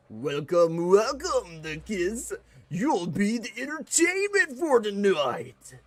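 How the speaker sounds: loud, high booming showman voice; giant demon host. Acted. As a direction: boom it out like an arena master. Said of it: "the voice changed".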